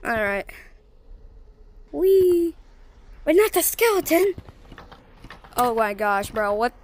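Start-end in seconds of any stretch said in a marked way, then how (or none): 1.9-4.3 high, loud squeal into alarm; high-pitched excited yelp; playful alarm. Real, not acted. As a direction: cry out high in playful dismay. Playful alarm at game skeleton.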